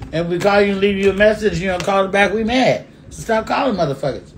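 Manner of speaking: high-pitched, insistent venting; emphatic rant. Real, not acted. Ranting advice about men's calls.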